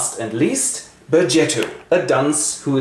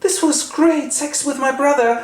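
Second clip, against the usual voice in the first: much higher, gushing; a pitched-up girlish confession; mock gushing sister; 13 semitones higher than usual. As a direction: gush it up high like a schoolgirl. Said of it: "and she goes".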